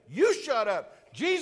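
high, loud defiant cry; defiant shout. Retold or imitated. Blind man shouting past the crowd to Jesus. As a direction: shout back and call out past them. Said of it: "He's saying".

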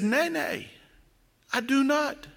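a hint of a boy digging in his heels; stubborn village boy. Village idiot to the parson, quiet stubborn refusal.

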